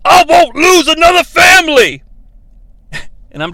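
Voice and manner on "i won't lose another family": loud, high hammed-up shout; over-the-top shouted vow; mock dramatic yell. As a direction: bellow it like a tortured hero. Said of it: "and he says".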